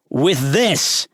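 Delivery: roaring. A narrator's reading. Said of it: "I roar".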